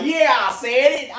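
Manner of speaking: high, cocky "yeah, I said it"; smug imitation. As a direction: play Carcino proudly owning his words. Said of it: "With a smile on my face".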